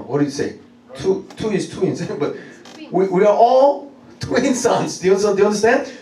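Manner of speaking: ordinary delivery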